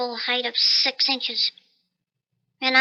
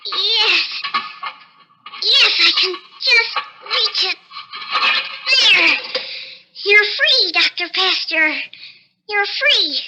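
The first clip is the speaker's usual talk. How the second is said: higher and louder, thrilled it worked; straining, excited; 6 semitones higher, 4 dB louder, slower than usual. Acted. Tiny man freeing his parakeet, higher, excited. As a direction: reach up and crow with triumph.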